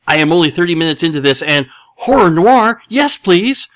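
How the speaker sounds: high, loud eager quote; excited. Retold or imitated. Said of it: "So, he's super excited".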